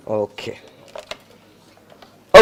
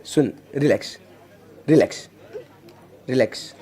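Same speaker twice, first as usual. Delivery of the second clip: hushed, lower soothing relax; calm her down gently and softly; a soft, low lullaby of relax; 7 semitones lower, 11 dB quieter than usual. Shown as a demonstration. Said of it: "अगर मैं ऐसे बोलूंगा ना".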